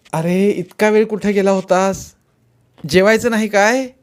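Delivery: exasperated maternal scolding; scolding mother. A narrator's reading. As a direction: chide a late child like a mother. Mother scolds son for being late.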